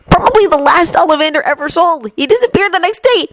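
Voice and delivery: put-on high boyish voice; proud boyish aside; Neville voice. A narrator's reading.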